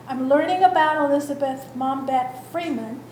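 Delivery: ordinary delivery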